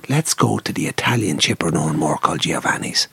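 lightly put-on English accent, hushed; English accent again. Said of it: "But on the accent again, go on".

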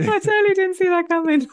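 high, loud words through real laughter; can barely get it out for laughing; breathless, delighted laughing; laughing while talking. Real, not acted. Laughing after the punchline.

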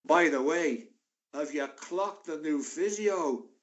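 slow, drawn-out mock booming voice; big booming voice. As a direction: lightly play a big bully announcing himself to the room. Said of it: "this big booming voice".

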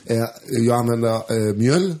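Swedish with a light French accent; understated French accent, lightly in the background; hesitant French-accented Swedish, not overdone. Shown as a demonstration. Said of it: "Han pratar så här".

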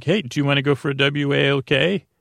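ordinary talk

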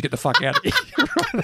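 boss quote, laughing. Retold or imitated. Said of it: "he's like".

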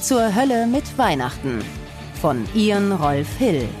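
ordinary talk

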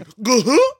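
high cartoon chuckle, loud; Goofy's laugh. Host mocking co-host, high Goofy laugh. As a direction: hiccup out a goofy "uh-huh". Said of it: "you did Goofy's laugh".

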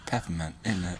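soft mock-English accent; Michael Caine. Co-host joining the running impression, soft mock-English. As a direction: say it lightly as an English film star. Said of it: "I'll just do that for every episode".

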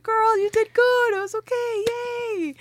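high, bright cheer; cheerful best friend. Retold or imitated. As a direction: cheer her on, high-pitched.

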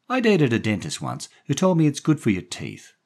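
high, loud woman's voice; chatty woman character. A narrator's reading. Daphne offering the boy a drink, chatty woman.

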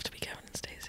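hushed whisper. Acted. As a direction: whisper it like a secret. Whispered joke answer.

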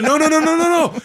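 high, loud string of protests; head off a friend's panic, hammed up; imitating a frantic friend; retold urgent reassurance. Friend hurriedly soothing his panic, retold.

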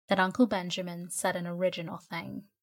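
ordinary delivery, nothing marked

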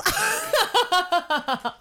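squealing high laugh. Real, not acted. Laugh heard, far.

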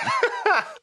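high burst of laughter; amused laugh. Real, not acted. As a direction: crack up laughing. Laughing at ringtone remark.

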